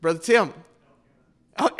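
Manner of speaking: normal talking voice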